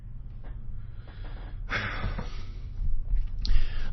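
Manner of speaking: long, deep exasperated exhale; worn-out sigh; heavy sigh. Acted. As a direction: let all the air out in disgust. After the outburst.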